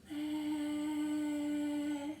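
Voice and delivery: lightly breathy wind voice; like wind through the sea; breathy whispery sound. Acted. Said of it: "breathy and whispery".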